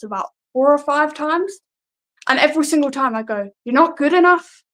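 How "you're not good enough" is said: higher Newcastle-like lilt on a promo line; Newcastle-like accent. Retold or imitated. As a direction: let an unintended Newcastle accent take over. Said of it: "with this accent".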